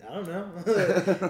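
slow, laughing "I don't know"; slurred laughing shrug; laughing drunk reply. Retold or imitated. Drunk reply to the tough guy.

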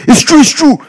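high, loud, repeated insistence; excited agreement. Retold or imitated. Man astonished by the prophecy, high and loud. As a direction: blurt it out in amazement.

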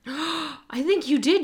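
surprised. Real, not acted. Gasps, recalling a memory.

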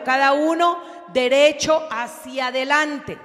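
loud, proclaiming scripture reading; preaching scripture. Acted. Preacher reading Joshua aloud to the church, loud and proclaiming. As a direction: read it out like a herald. Reads scripture at full force.

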